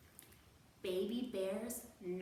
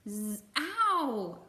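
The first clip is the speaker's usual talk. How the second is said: waking with an ow; 3 semitones higher, 6 dB louder than usual. Acted. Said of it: "cried Baby Bear".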